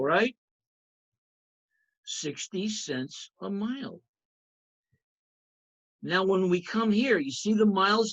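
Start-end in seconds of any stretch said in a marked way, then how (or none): 2.0-4.0 slow, soft restatement of "Sixty cents a mile"; calm, drawn-out repeat for the class; slow, softer repeat. Shown as a demonstration. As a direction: let "mile" settle gently, more slowly and much quieter. Teacher repeats the rate slowly.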